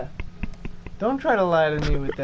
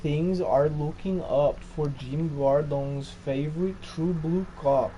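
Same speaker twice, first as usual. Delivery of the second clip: put-on Portuguese accent; slower than usual. Friend reading a comic profile on request, Portuguese accent. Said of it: "Portuguese accent".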